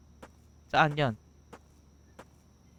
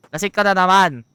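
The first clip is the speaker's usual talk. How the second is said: louder, higher scolding repeat; 5 semitones higher, 10 dB louder than usual. Real, not acted. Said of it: "Oh, lasing ka na naman".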